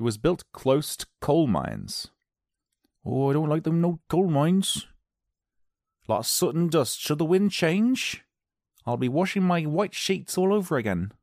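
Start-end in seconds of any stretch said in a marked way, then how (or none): 3.0-11.1 high-pitched complaining local; grumbling villager. Acted. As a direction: put on a fussy villager fretting over laundry. Acted local complaining about coal mines.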